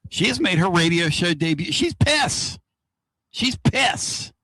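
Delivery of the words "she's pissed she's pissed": mock angry wife. Voicing his angry wife.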